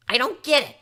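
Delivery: a brash pony loudly not understanding; loud confused blurt. Acted.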